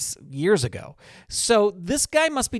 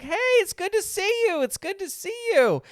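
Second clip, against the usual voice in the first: high imitated shop owner, gushing; excited greeting. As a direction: play the owner thrilled to see a regular. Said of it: "he was really excited".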